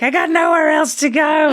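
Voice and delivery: high, shouted desperate cry; a trainee begging her drill sergeant to keep her; Officer and a Gentleman plea. Retold or imitated. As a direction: yell it like a broken recruit at boot camp. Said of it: "she's like".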